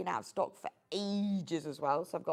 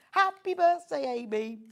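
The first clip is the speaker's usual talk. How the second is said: loud, high-pitched well-wishing; excited birthday greeting; 6 semitones higher, 5 dB louder than usual. Real, not acted. Presenter greeting the designer who may be watching, excited. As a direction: send a big cheery greeting.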